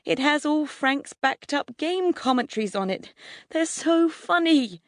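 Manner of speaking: put-on high, earnest boy's voice; anxious pleading. A narrator's reading. Neighbour boy defending the computer, pleading. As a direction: argue for it in a high, eager plea.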